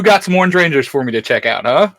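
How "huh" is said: ordinary talk, nothing marked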